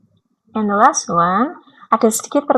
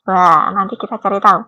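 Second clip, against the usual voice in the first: ordinary talk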